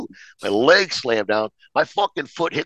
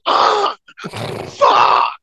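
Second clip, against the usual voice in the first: high yelp then a breathy groaned curse; pained cry. Retold or imitated. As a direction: cry out as the sore shoulder is hit. Friend slaps his broken shoulder.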